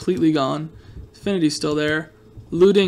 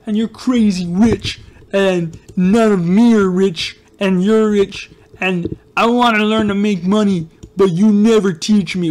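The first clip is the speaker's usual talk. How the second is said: mock whiny kid complaining, a bit louder; hammed-up jealous viewer voice, slowing on dumb dumb face; 2 semitones higher, 4 dB louder, slower than usual. Imitating a jealous viewer whining at the streamer. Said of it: "You're like".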